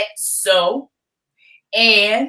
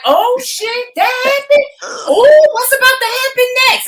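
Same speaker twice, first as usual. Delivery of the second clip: thrilled shock; 12 semitones higher, 7 dB louder than usual. Retold or imitated. Co-host replays being hooked when she first started, thrilled shock. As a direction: act out being hooked and dying for more. Acting out her hooked reaction.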